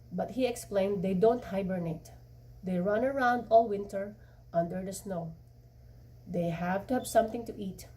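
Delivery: ordinary delivery, nothing marked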